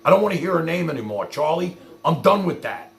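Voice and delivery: ordinary delivery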